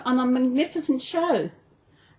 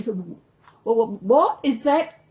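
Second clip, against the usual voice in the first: stammering, slow and scared; trembling; much slower than usual. A narrator's reading. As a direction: stutter it out, frightened in the dark.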